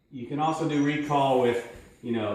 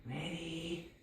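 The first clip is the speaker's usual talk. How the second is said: soft, slow teasing ready; hushed build-up; 2 semitones higher, 13 dB quieter, slower than usual. Shown as a demonstration. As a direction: hold them back with a hushed ready. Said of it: "Get a toy they really like".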